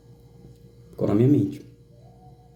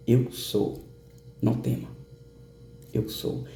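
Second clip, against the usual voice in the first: sweet feminine voice; 3 semitones lower, 8 dB quieter, slower than usual. Retold or imitated. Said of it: "aquela voz pura, aquela voz doce, aquela voz meiga".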